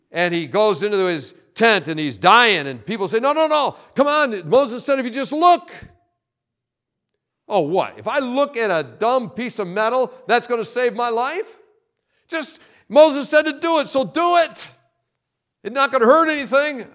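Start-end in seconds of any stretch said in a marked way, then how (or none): 3.2-5.7 high, loud urging of a dying friend; urgent pleading. Acted. Bystanders urging the bitten man to look, high and pleading. 7.5-11.6 mildly raised incredulous scoff; a light touch of a man mocking the idea; scoffing disbelief. Bitten man scoffing at the bronze serpent, mildly incredulous. 12.3-16.8 high, loud pushing to just do it; insistent urging. Acted. Friends insisting the dying man look, high and loud.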